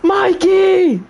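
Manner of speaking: high, drawn-out wail of a name; melodramatic cry. Pretend. Player calling out Mikey, melodramatic cry. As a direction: cry out in mock horror.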